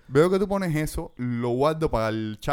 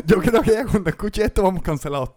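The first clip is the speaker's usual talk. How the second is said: laughing hard; 5 semitones higher, 6 dB louder than usual. Real, not acted. Laughing at the thought.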